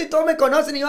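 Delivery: usual speaking voice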